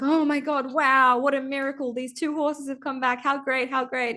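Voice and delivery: hammed-up delighted neighbours; amazed villagers. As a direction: marvel like a crowd at a miracle. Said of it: "everyone's like".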